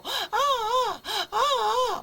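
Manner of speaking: put-on very high sleepy-baby wails, repeated; imitated baby crying. Shown as a demonstration. Teacher shows how some sleepy babies cry, imitated wailing. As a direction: wail in bursts like a sleepy baby. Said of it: "뭐 이렇게 울고".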